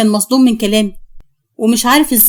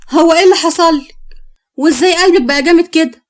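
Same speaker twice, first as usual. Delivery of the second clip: higher-pitched incredulous questioning; indignant disbelief; 5 semitones higher than usual. Acted. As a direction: demand an explanation, taken aback. Husband confronts suddenly defiant wife.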